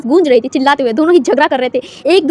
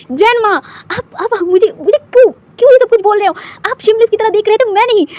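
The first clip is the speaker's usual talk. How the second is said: higher, rising protest; high-pitched yelling protest; 7 semitones higher than usual. A narrator's reading. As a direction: shout it back at grandma. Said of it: "ये कहकर वो चिल्लाने लगा था".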